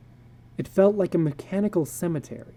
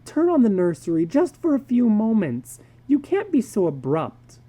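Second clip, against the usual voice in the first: pleading wife; 7 semitones higher than usual. Acted.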